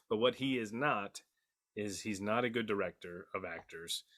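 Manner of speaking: ordinary talk, nothing marked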